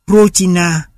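"Rochina" with the stress on its first syllable, Ro. Shown as a demonstration. Lecturer giving a Russian example of free stress, first-syllable stress on "Rochina". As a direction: hit the Ro of "Rochina". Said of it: "nó ở âm tiết đầu".

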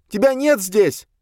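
high, loud, slow denial; high drawn-out frantic cry; screaming. A narrator's reading. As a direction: shriek it to deny the ghost. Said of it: "закричала Долорес".

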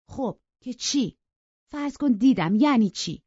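high and loud, dismissive; so what, challenge him; defiant girl shrugging off his reproach. A narrator's reading. Cathy defiant in quarrel.

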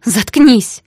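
sharp, high, loud command; explosive angry outburst; shouted. A narrator's reading. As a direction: shout him down furiously. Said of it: "выкрикнула я".

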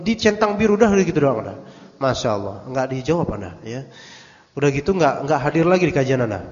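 ordinary talk, nothing marked

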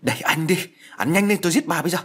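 enraged daughter-in-law yelling as she force-feeds; furious yell. Acted. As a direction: shriek the death threat while force-feeding. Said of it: "vừa quát lớn".

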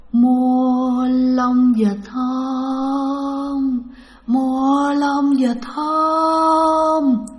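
long drawn-out ghostly vendor chant; eerie moaning call. Retold or imitated. Unseen voice calling to buy duck feathers in the graveyard, eerie moan. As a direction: moan each word out, very slowly. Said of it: "Tiếng kêu mà rên nha các bạn, giọng ồn ồn rất là ghê".

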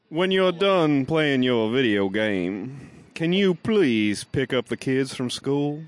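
put-on genteel Southern accent, unhurried; Southern gentleman. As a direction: draw it out like a courtly Southern gentleman. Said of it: "Southern gentleman".

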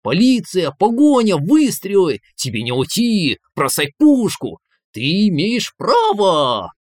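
high, loud hammed-up nasal cop lines, slowing at the end; nasal movie cop. Inner voice quotes movie cops, nasal and hammed-up. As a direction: quote action-film police lines in a nasal drone. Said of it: "Гнусаво процитировал он".

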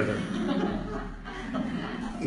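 a high, breathy burst of laughter; laughing burst. Real, not acted. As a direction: crack up at the punchline. Laughs at the kibbutz punchline. (risa aguda y aireada)

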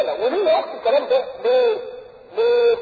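usual speaking voice

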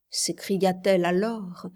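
ordinary delivery

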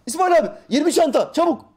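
yüksek perdeden, güçlü uzaktan seslenme; bağırma. Shown as a demonstration. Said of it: "uzak olduğu zaman bağırırsın". (uzağa bağırarak seslenme, yüksek sesle)

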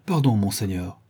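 usual speaking voice

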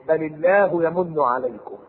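slow chanted verse; Quranic recitation. Acted. Preacher reciting to congregation, slow chant. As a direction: intone the verse slowly.

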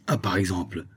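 ordinary delivery

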